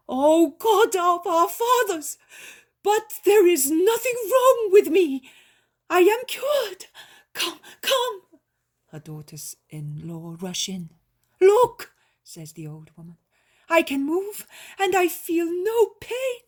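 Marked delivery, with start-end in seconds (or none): 0.1-8.2 high, much louder joyful cry; cries out. A narrator's reading. Healed old woman crying out in joy to her family. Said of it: "the old woman cries out". 11.4-11.9 high, much louder excited call; joyful call. A narrator's reading. Old woman excitedly calling her daughters-in-law to look.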